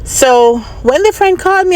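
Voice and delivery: ordinary talk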